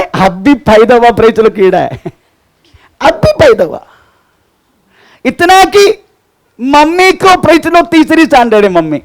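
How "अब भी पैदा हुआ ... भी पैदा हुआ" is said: ordinary delivery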